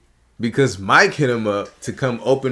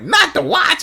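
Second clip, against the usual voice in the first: high-pitched mock cry; high playful quote; 14 semitones higher, 4 dB louder than usual. Greeting a comedian he admires, high playful voice. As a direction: throw it out high, as a bit.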